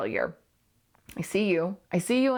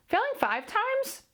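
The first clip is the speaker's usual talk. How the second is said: drawn-out, much higher exasperated rise; slower than usual. Real, not acted. Host to listeners, exasperated at failing again. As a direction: stretch it slowly, rising in disbelief. Exasperated about repeated failure.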